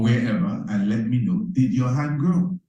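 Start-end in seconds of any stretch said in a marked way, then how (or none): none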